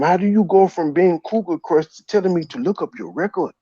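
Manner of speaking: ordinary talk, nothing marked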